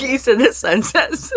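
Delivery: squeezing the words out between laughs; laughing while teasing her friend. Real, not acted. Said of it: "I'm like".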